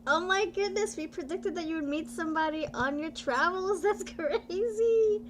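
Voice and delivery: high, excited gush with a laugh; thrilled amazement. Real, not acted. Host tells Jeff the prediction came true, thrilled amazement.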